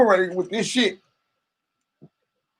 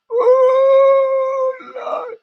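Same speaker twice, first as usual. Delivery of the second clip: laughing wail; 3 dB louder, much slower than usual. Real, not acted. Laughing fit on stream.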